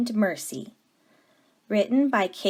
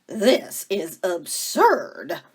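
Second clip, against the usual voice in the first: slow, louder, disapproving sister; disdainful Eugenia; 6 dB louder, much slower than usual. Acted. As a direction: drag it out with contempt.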